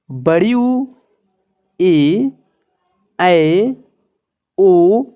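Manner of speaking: each vowel said slowly and clearly on its own, "अ", "आ", "ई"; reciting Hindi vowels. Shown as a demonstration. Teacher to students, reciting the vowel chart slowly. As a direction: sound out each vowel for the class. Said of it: "यहाँ पे ये".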